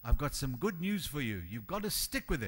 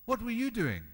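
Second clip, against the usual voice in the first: raised question; 6 semitones higher than usual. Acted. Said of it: "repeated question, varied delivery".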